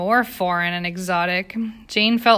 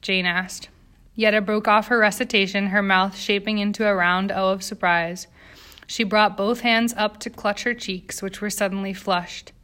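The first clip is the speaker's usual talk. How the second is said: ordinary delivery, nothing marked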